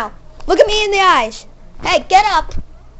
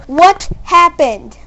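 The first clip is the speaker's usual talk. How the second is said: slow; 4 dB louder, much slower than usual. Real, not acted. Demanding answer from the cat.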